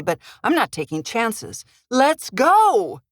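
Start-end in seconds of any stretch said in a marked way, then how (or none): 1.9-3.0 raised, higher urgent call. Acted. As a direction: hurry her along, loud and high. Mother hurrying daughter in storm.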